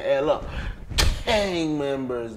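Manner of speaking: ordinary talk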